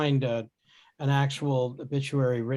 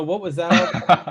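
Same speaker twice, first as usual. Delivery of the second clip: laughing imitation; 3 semitones higher, 7 dB louder than usual. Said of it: "just go".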